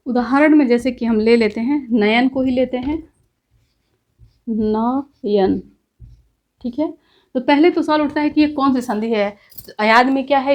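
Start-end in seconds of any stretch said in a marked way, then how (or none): 4.5-5.6 नयन drawn out very slowly, syllable by syllable; teacher slowly sounding out नयन; slow demonstration. Shown as a demonstration. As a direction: stretch नयन out so students hear each sound. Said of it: "अभी इसको बढ़िया से देखेंगे".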